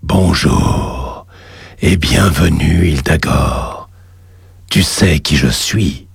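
deep, slow demon lord voice; low and slow, a voice from the depths; cavernous voice. Acted. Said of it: "une voix caverneuse".

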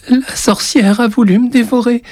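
put-on a terrified boy running to grandpa; tearful child. A narrator's reading. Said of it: "les larmes aux yeux". (petit garçon au bord des larmes)

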